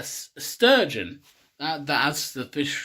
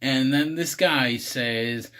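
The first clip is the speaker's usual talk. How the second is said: ordinary talk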